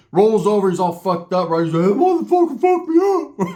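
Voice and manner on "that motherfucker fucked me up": laughing complaint. Retold or imitated. Sharky voiced, laughing.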